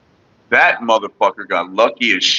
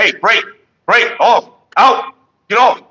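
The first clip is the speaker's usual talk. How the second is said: commanding shout; 8 semitones higher than usual. Retold or imitated. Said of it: "tell the dog to get".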